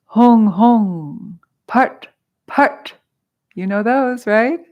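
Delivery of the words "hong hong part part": loud, higher intoned bursts; ringing syllables, then sharp punched bursts; powerful seed syllables. Shown as a demonstration. Teacher demonstrating the sonic symbols, powerfully intoned. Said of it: "powerful seed syllables".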